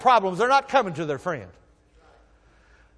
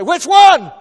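much higher, louder mock challenge; pretend retort; 11 semitones higher, 14 dB louder than usual. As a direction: snap back at the singer. I wanted to stand up and say.

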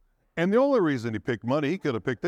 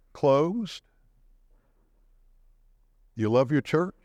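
ordinary talk, nothing marked

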